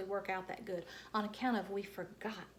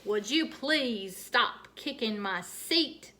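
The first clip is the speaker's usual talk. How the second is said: slow, louder grouchy request; grouched; 2 semitones higher, 7 dB louder, much slower than usual. Acted. Grouchy lady scolding the kid behind her, slow and cross. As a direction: drag it out like an annoyed stranger. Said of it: "she grouched".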